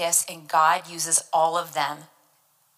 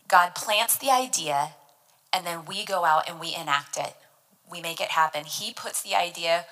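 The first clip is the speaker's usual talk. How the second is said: ordinary talk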